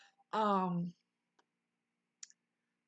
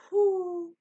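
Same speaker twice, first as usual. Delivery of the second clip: big exhale; 9 semitones higher, 8 dB louder than usual. Retold or imitated. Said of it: "Then I went".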